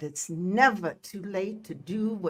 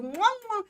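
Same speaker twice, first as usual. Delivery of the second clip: blown kisses; 12 semitones higher than usual. Real, not acted. Blows kisses to Bernadette.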